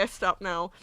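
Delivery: ordinary delivery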